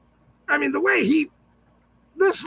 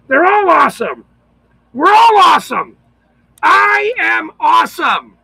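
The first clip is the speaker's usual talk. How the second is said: loud, building self-hype; mock triumphant yell; loud boast; 2 semitones higher, 7 dB louder than usual. Real, not acted. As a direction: crow it like a proud showman. Self-hyping exclamation.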